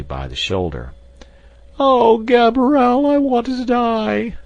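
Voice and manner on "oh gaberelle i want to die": despairing cry. A narrator's reading. Maid with eyes closed cries out, high despairing wail. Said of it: "cried the poor woman".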